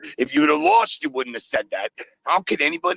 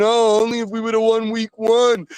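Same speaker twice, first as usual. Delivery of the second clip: high, louder whiny mock voice; mocking regret; 10 semitones higher, 4 dB louder than usual. Caller mimicking regretful fans, high whiny mock. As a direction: whine it like a sore fan.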